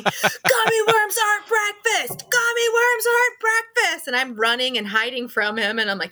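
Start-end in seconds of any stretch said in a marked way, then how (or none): 0.0-4.1 high, drawn-out scolding yell; hammed-up high-pitched telling-off; mock angry yelling. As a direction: imitate a friend hollering in outrage, word by word. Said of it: "yelling at me".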